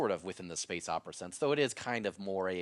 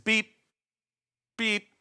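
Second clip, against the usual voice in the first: imitated beep; 12 semitones higher, 7 dB louder than usual. Imitating radio button beeps.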